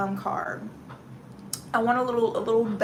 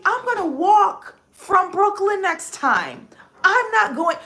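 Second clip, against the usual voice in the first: fed-up vow; 9 semitones higher, 8 dB louder, slower than usual. Retold or imitated. Quoting her resolve in subway.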